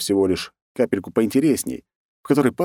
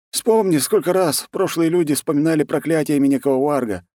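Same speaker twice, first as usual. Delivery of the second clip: higher-pitched monk voice; insistent monk; 8 semitones higher than usual. A narrator's reading. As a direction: press your warning on the commander, pitched up. Monk pleads with commander. (настойчивый монах, высоким голосом)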